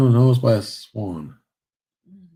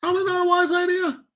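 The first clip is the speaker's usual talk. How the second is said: high shout. Real, not acted. Shouted aside, measures high.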